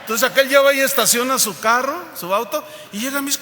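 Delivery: ordinary talk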